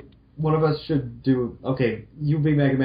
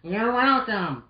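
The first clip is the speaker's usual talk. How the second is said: a light Roll voice; 11 semitones higher, slower than usual. Acted. Roll's voice.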